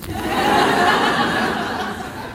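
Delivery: quiet breathy chuckle; a soft laugh to yourself; soft airy laugh. Real, not acted. Laughs after dad story.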